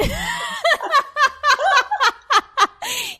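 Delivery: loud high peal of laughter; high shrieking laugh. Real, not acted. Cracks up at innuendo.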